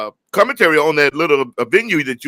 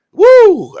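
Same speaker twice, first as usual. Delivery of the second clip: excited whoop; 10 dB louder than usual. Real, not acted. Excited about New York trip.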